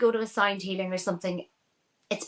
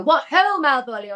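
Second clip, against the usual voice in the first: lightly imitated posh English actor, higher and louder; a light send-up of the posh drama-school "What ho, Malvolio?"; posh English accent; 5 semitones higher, 5 dB louder than usual. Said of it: "people would go".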